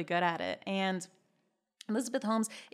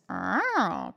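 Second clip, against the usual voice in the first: cat sound; 4 dB louder than usual. Pretend. Host jokes that the texts are catty, imitated cat yowl. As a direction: let out a catty meow, rising then falling. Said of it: "cat sound".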